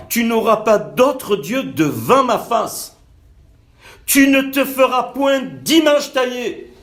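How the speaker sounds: plus haut, lent et fort, proclamé; prédication fervente. Acted. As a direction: proclamer la Loi avec ferveur. Lit le commandement avec ferveur. (lecture prêchée, plus aiguë, lente et forte)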